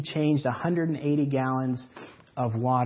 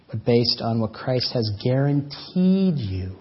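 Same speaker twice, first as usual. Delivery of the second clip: loud, driving sermon build; preaching at full force; 5 dB louder than usual. Acted. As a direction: preach it out to the whole church.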